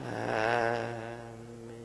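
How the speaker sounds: very soft, dragging chant; barely voiced, stretched syllables; too slow. Shown as a demonstration. Showing a weepy deathbed chanter, far too slow and faint. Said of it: "哎，不能太慢".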